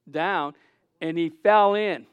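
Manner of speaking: usual speaking voice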